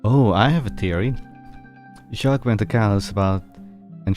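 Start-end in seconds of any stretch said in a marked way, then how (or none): none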